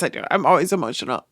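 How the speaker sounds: ordinary delivery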